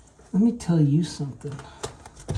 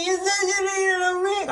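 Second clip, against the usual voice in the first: high fast squeaky voice, much higher; helper's voice; faster than usual. Retold or imitated. Boss imitating the helper fast in a high squeaky voice. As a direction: rattle it off like a cartoon kid.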